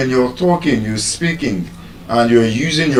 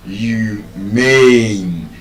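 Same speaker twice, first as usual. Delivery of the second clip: humane split slowly, MANE louder and longer; stress on second syllable; 2 semitones lower than usual. Shown as a demonstration. Teacher asking where voice is louder, humane with second syllable boldly drawn out. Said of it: "Where is the voice louder?".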